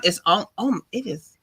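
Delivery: ordinary talk, nothing marked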